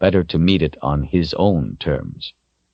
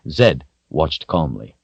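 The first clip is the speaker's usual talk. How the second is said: ordinary delivery, nothing marked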